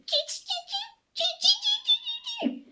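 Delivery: very high squeaky monkey chatter. A narrator's reading. Monkeys answer the peddler in very high chatter. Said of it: "and they said".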